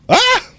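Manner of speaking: short, very high-pitched startled yelp; sudden scream. Real, not acted. Streamer getting grappled in the game, sudden high scream. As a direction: let out a sudden piercing "Ah". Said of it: "Sorry to everyone's eardrums".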